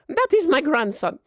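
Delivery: high, loud granny introducing her grandson; high-pitched, forceful grandma voice; blunt old grandma. Acted. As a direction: dismiss him as a bossy old woman. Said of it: "she goes".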